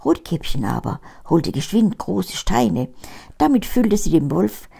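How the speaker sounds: ordinary delivery, nothing marked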